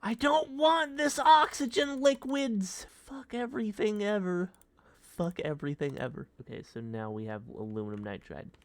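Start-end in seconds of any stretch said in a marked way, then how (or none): 0.0-2.9 mock outburst. Outburst at game items. 2.9-4.5 high frustrated outcry. Acted. Repeated curse, first take.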